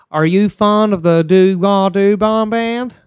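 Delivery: high, loud, slow Southern parody; mock Alabama accent. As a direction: stretch it into an exaggerated Alabama accent. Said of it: "that is our equivalent of".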